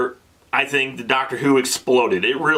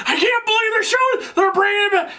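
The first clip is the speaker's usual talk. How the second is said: much higher, thrilled squeal; excited disbelief. Retold or imitated. To his wife watching the revival, thrilled high squeal. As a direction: gush like a fan who can't believe it. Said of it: "I was like all excited".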